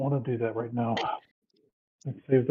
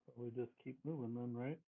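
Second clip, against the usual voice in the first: barely audible, much softer than usual; 20 dB quieter than usual. Real, not acted. Player checking the group keeps moving, very softly. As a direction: speak very low, hardly carrying. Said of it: "You're coming in really low".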